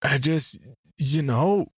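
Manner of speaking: halting, unfinished thought; trailing off. Acted. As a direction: grope for words and leave it hanging. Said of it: "I trailed off".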